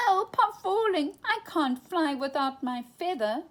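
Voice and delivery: high panicked cry; frightened cry. A narrator's reading. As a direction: cry out while falling. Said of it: "cried the little elephant".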